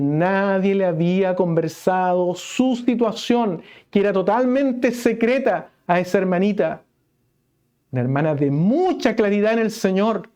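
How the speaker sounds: warming into the sermon; fervent preaching. Acted. Preacher stressing the secret sin, rising into fervent preaching.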